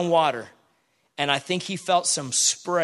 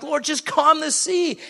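pleading prayer; 10 semitones higher, slower than usual. Retold or imitated. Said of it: "I'm like".

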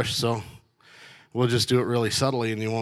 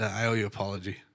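apology; 4 semitones lower, 7 dB quieter, faster than usual. Retold or imitated. Apology to the nurse retold.

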